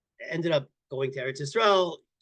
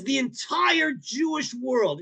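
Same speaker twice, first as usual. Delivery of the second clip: emphatic declaration; 7 semitones higher, much slower than usual. Retold or imitated. Guest lecturing the rabbi, slow emphatic shout. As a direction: announce it slowly and forcefully. Guest begins his speech.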